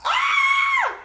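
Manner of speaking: high piercing fan scream; sudden high loud squeal of joy. Retold or imitated. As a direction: shriek like a starstruck fan. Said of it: "I was like".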